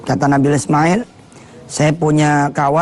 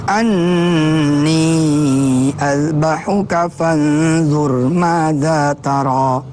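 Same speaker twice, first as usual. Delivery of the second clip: Quranic recitation; much slower than usual. Acted. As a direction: chant each Arabic phrase slowly and melodically.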